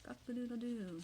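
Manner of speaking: high imitated cock-a-doodle-doo; rooster crow. Rooster crows after Peter's denial, high imitated crowing. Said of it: "cock crows".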